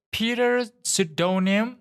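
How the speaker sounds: high, slow rising call of a name; nurse calling out. A narrator's reading. Nurse calls the name into the waiting room, high slow rising call. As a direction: stretch the name out high, questioning.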